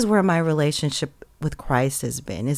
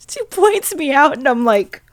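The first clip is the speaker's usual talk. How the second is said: ordinary talk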